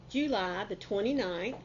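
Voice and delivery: usual speaking voice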